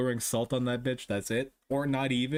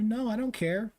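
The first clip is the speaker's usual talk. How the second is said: high dismissive voice; 9 semitones higher than usual. Retold or imitated. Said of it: "And it's like".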